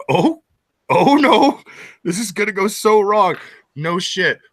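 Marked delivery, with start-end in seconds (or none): 0.1-3.4 higher, louder rising alarm; oh no, this will go wrong; worried dread. Retold or imitated. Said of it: "I'm just like".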